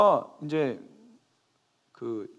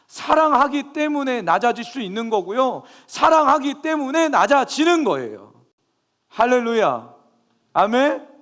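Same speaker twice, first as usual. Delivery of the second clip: loud, high fervent preaching; impassioned sermon climax, loud and high; full-force preaching; 9 semitones higher, 8 dB louder than usual. Acted. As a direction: preach it out to the congregation.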